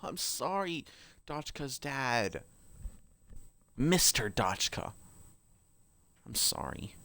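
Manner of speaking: very soft, slow condolence; hushed, drawn-out pity; mock mourning. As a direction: apologise softly to the character. Mock-mourning the character's dead father.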